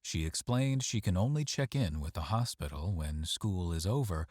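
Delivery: ordinary delivery, nothing marked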